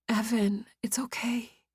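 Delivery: soft unvoiced whisper; tender whispered comfort. A narrator's reading. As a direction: hush him gently. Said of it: "I whispered".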